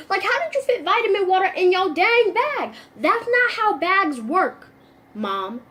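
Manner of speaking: baffled and loud, like nothing fits in that bag; incredulous. Acted. Teasing the mom friend about her bag, loud and incredulous.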